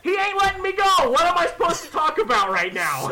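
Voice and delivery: high, protesting retort; exasperated shout. Retold or imitated. Visitor answering Hannah while held up, exasperated shout. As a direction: yell back in helpless exasperation.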